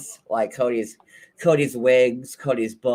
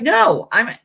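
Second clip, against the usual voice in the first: fed-up outburst; 7 semitones higher, 4 dB louder than usual. Retold or imitated. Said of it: "and Christine's like".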